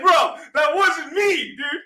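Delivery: high, loud, stretched-out denial; exasperated retold yelp of denial; protesting. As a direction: shout your innocence in mock outrage. Retold protest at being blamed.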